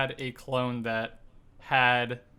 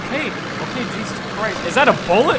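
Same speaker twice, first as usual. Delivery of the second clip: loud, high, frightened shouting; panicked yelling; 7 semitones higher, 11 dB louder, faster than usual. Acted. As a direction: yell in terror at the bullet. Bullet ricochets near them.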